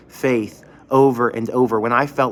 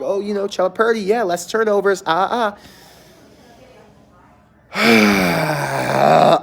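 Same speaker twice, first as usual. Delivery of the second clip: high, drawn-out mock of a doubting fan; mocking a skeptical fan; 6 semitones higher, much slower than usual. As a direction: imitate the guy's dismissive sighing. Guy at game dismissing Purdy.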